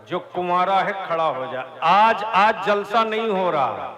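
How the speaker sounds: loud, fast, urgent; preacher demanding the unmarried stand up; rousing call. Real, not acted. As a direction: fire up the crowd.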